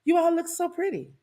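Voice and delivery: high sing-song fake praise; sarcastic sweetness. Shown as a demonstration. Preacher shows the congregation the singer's sarcastic compliment. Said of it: "Don't you feel that sarcasm?".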